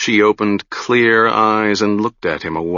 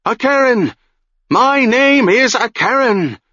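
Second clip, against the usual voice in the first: screamed aloud; 13 semitones higher than usual. A narrator's reading. Broken witch screams her true name aloud, much higher. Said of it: "Then she screamed aloud".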